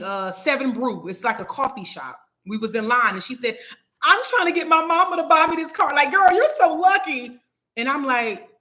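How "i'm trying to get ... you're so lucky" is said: put-on high-pitched girl voice, gushing with envy; imitating envious girl. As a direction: mimic her in a raised, excited voice.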